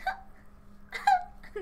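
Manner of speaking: airy high laughing fit; breathless laughter. Real, not acted. Laughing over hiccups.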